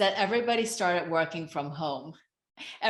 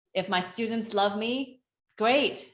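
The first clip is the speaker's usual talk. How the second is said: ordinary talk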